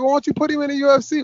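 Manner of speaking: high-pitched, quick mock British fan. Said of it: "in my British voice".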